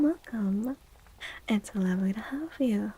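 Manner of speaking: ordinary talk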